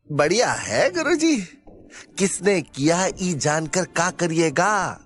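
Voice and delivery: high-pitched, rustic Bihari character voice; cheery Bihari sidekick. Acted. Sidekick telling guruji to stop worrying, cheery. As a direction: reassure in a high, lilting sidekick voice.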